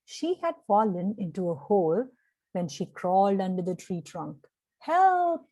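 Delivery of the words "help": high, loud call for help; yelled help. A narrator's reading. Girl stuck in a cave, shouting for help. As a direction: shout it up out of the hole. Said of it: "she yelled".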